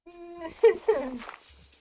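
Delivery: high, loud burst of real laughter. Real, not acted. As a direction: crack up at your own silly joke. Cracking up at own joke.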